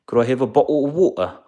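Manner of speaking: high, loud mock-British voice; British accent. Shown as a demonstration. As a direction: put on a hammy British accent. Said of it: "in a British accent".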